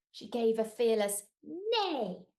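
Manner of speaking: ordinary delivery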